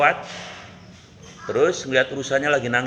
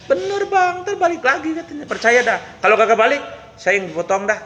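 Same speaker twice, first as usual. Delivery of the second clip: high insistent voice; 10 semitones higher than usual. Acted.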